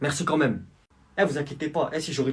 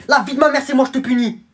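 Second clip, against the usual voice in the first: high furious yelling; angry shout; 10 semitones higher, 9 dB louder than usual. Acted. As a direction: scream the punishment. Judge yelling at the defendant.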